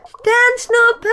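very high, full shout into the well; loud call. A narrator's reading. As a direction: yell the name down the well. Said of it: "ruft einmal laut". (Ponifee ruft laut in den Brunnen)

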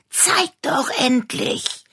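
high, slow pleading whine; begging. A narrator's reading. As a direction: beg impatiently.

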